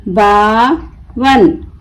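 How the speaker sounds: बावन slowly split, long बा, pause, वन; broken into syllables. Shown as a demonstration. Teacher breaking the word for learners, बा... वन with a gap. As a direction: pull बावन apart into बा and वन. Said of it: "तोड़ तोड़ कर पढ़ूंगी".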